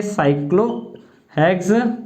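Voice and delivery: slow cyclohexane with pauses between its parts; half-speed, broken-up cyclohexane; syllable-by-syllable dictation. Shown as a demonstration. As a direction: stretch cyclohexane out for note-takers. Teacher dictates the term in pieces.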